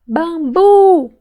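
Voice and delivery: "Bambu" with a loud, high, long BU; stretched stressed syllable. Shown as a demonstration. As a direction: call out "Bambu", holding BU. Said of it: "Qual que eu demorei mais falar".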